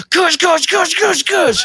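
high loud rapping goose sounds; loud knock noises; knocking imitation. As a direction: mimic the door knocks.

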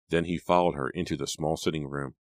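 ordinary delivery, nothing marked

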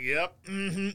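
soft, casual yep; barely bothered mumble; nonchalant murmur. Retold or imitated. Players powdering nonchalantly.

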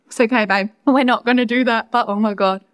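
ordinary delivery

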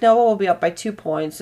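normal talking voice

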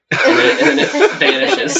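high, loud, cracking up while talking; laughing. Real, not acted. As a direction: narrate through real laughter. Table cracks up at the joke.

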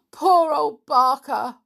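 gasp, then a high, loud lament; acted heartbroken cry; choking voice. A narrator's reading. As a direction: wail it slowly, choked with grief. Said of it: "said the boy in a choking voice".

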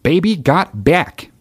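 slow, high mock Alaskan twang; Sarah Palin impression. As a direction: drag it out in a folksy Palin accent. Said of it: "She's got that fucking thick accent".

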